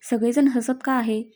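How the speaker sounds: ordinary delivery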